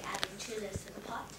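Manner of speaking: ordinary delivery, nothing marked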